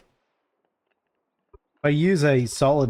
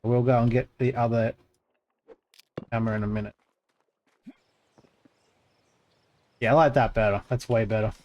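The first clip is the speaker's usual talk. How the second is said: talking while crunching snacks; crunching chips; faster than usual. Real, not acted. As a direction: speak through crunchy chewing. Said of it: "sorry for all the crunchy sounds you're about to hear".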